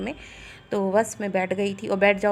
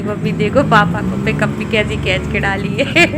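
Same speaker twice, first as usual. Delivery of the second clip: quoting her daughter through real laughter; the words bubble with giggles; laughing; 8 dB louder than usual. Real, not acted. Mother retells her daughter's joke, laughing. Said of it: "तो मुझे इतनी हंसी आ रही थी".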